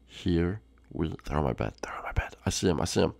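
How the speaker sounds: hushed, low whisper while sneaking up; stealthy whisper. Pretend. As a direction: keep it secret from the intruders. Sneaking up on intruders.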